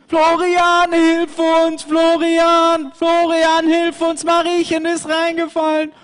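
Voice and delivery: high, loud call to the shepherd; calling for help. Acted. Pauli calls Florian to help, high and loud. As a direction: shout across the field to Florian.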